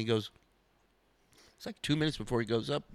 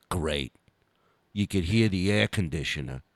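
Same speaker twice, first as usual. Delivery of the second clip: much lower, dry mock deadpan; deadpan Dice; 8 semitones lower than usual. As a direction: drop it low and dry like a bombing comic. Dice deadpanning over silence.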